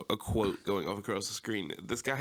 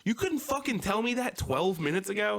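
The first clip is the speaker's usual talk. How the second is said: much higher, exasperated mock yell; retold angry yell; 12 semitones higher, 4 dB louder than usual. Yelling at the worker about the wait, mock exasperated. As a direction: let the outrage spill out, half-acted. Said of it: "I got pissed".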